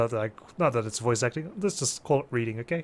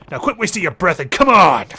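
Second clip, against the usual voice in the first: loud, raised fighting challenge; shouted challenge; 4 semitones higher, 12 dB louder than usual. Acted. Fighter daring the mob to attack, shouted challenge. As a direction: shout it to start the brawl.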